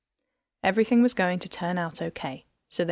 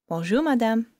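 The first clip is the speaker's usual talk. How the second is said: Bonjour, madame in a lightly voiced thick Parisian accent, madame with a nasal French ending; a touch of a Parisian chauffeur's thick French accent; 4 semitones higher, 4 dB louder than usual. A narrator's reading. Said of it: "he said in a thick Parisian accent".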